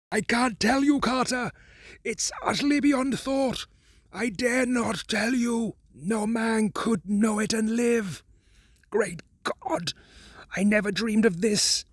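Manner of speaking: a man overwhelmed by what he sees; fear and despair. Acted. Warren refusing to tell Carter, in despair. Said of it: "still hoarse with fear, and now apparently tinged with despair".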